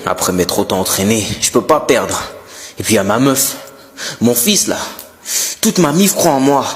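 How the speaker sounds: inner monologue blurted at full volume; thinking out loud. Acted. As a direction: say your private thoughts far too loudly. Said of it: "tu parles pas dans ta tête".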